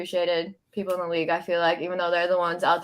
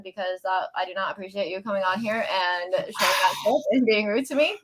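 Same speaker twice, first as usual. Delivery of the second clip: usual speaking voice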